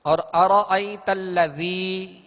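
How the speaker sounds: अरअयतल्लज़ी slowly, with the ज़ाल said softly as a soft th sound; अरअयतल्लज़ी with a gentle, soft ज़ाल; soft zaal. Shown as a demonstration. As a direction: draw out अरअयतल्लज़ी and soften the ज़ाल. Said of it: "ज़ाल नरमी के साथ अदा करेंगे".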